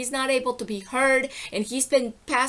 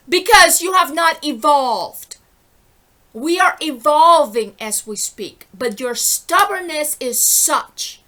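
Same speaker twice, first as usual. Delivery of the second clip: loud, slow scolding; angry preaching; 3 semitones higher, 8 dB louder than usual. Real, not acted. Scolding stubborn listeners: loud, slow, angry preaching. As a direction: lay it on them hard and slow.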